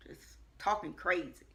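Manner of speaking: ordinary delivery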